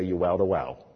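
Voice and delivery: "wowed a wow" with W standing in for V; German V as W. Retold or imitated. Teller recalling the scholar's words, "wowed a wow" with W for V. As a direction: lightly echo the German slip in "wowed a wow". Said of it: "she meant vowed a vow".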